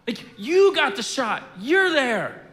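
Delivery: a moderate angry rant; bitter outburst. Retold or imitated. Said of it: "he's like".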